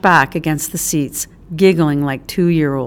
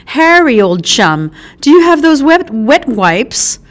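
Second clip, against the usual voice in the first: higher, louder hurried call to his chum; mock-posh urgency; urgent posh call; 7 semitones higher, 5 dB louder than usual. Posh fake schoolboy urgent request.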